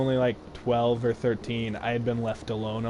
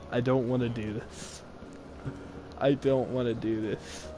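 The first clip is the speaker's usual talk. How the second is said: normal talking voice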